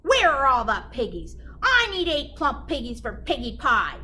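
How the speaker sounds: loud, demanding witch. Acted. Gritch demanding pigs from the cow, loud and bossy. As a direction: bark it out like a hungry witch.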